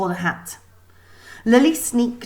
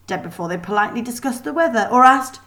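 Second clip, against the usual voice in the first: plain reading voice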